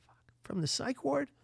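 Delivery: high whispered disbelief; stunned whisper. Retold or imitated. Margaret realizing the psych ward guy is calling, shocked whisper. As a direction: hiss it in hushed shock.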